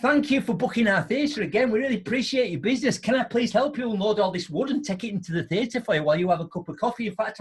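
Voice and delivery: lightly voiced overpolite attendant; hammed-up customer-care gush; mock grovelling steward. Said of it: "what you should be saying is".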